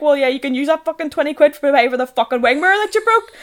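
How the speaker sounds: high, loud dad retort, laughing; imitated dad. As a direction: voice an exasperated dad while cracking up. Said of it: "my dad's like".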